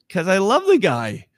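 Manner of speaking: high, swelling cry of love, loudest at "came back to life"; impassioned defense. Acted. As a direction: plead your heart out for your friend. Disciple answers angels, impassioned.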